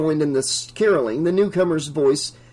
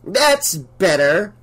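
higher, slow satisfied pronouncement; smug declaration; 4 semitones higher, 4 dB louder, much slower than usual. A narrator's reading. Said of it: "Missus Deagle declared, smiling".

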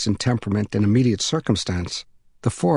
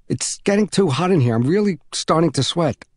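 put-on higher child's voice, complaining; worried young boy; 6 semitones higher than usual. Trapped boy telling neighbor he's too hot, higher and worried. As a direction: plead through the door like an uncomfortable boy.

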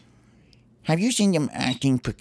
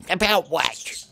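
grumpy squirrel snapping back; grouchy voice; 6 semitones higher, slower than usual. Acted. Squirrel answering the birds in a grouchy voice. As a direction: sound put out and grouchy. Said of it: "That was my grouchy voice".

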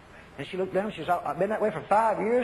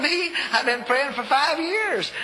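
indignant protest; 8 semitones higher, 3 dB louder, slower than usual. Retold or imitated. Preacher protesting to God.

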